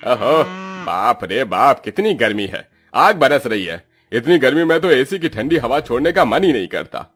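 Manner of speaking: theatrical grumbling about the heat; swooping, exaggerated complaint; pompous landlord, overheated. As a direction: moan grandly like a pampered boss.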